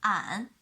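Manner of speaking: "an" in third tone. Shown as a demonstration. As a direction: dip "an" down then up. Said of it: "The third tone".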